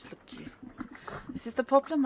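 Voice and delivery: a light Welsh lilt, a hint of grandmother; Welsh accent. Game master trying his grandmother's Welsh accent for the stall holder. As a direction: slip briefly into a Welsh valleys voice. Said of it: "I have to do an impression of my grandmother".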